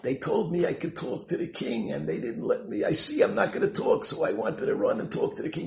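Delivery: put-on high, loud child's complaint; upset protest. Boy explains himself to the king, upset and loud.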